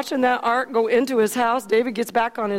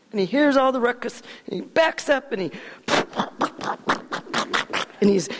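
usual speaking voice

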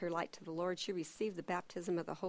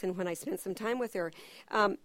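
ordinary talk, nothing marked

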